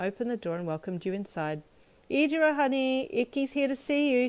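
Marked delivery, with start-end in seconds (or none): 2.1-4.3 high, carrying motherly call; calling upstairs. A narrator's reading. Mum calling her son upstairs, raised call. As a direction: call him down in a singing-out voice. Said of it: "she called up the stairs".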